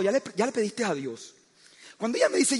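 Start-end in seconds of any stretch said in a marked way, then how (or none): 0.0-1.3 soft, sweet imitation of the wife; wife's soft question. Wife softly asks if he prayed, tender mock. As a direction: ask it tenderly, a touch naive.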